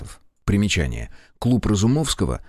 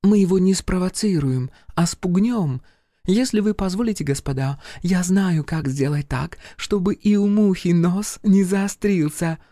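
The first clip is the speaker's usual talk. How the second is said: high-pitched French lady voice; femme fatale; 10 semitones higher than usual. Acted. Charlotte's voice again.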